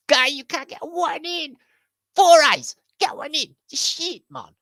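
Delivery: squeaky heckler mocking a bad player; high taunting voice. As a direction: jeer at him from way up high. Same jeering character returns.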